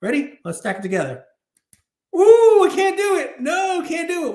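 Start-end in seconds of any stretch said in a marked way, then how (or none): 2.1-4.3 loud exasperated shout at the cards; frustrated shout. Real, not acted. Collector yelling at cards that won't stack, frustrated shout.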